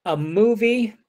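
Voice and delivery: "A movie" with a rising, questioning tune. Shown as a demonstration. Teacher showing a repetition, "A movie" rising. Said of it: "usually questioning intonation".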